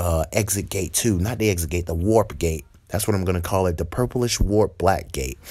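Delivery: usual speaking voice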